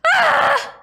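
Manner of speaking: high exasperated scream; frustrated scream. Real, not acted. Gamer failing the throw, high frustrated scream.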